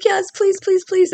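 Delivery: high pleading little voice. Retold or imitated. Wife begging husband to escort her, high pleading. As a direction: beg sweetly, up high. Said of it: "I was like".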